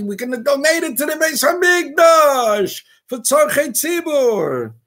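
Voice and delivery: high-pitched mocking plea, dropping at the end; mock self-justification. Rabbi mimicking donors who justify tainted money, high mocking protest.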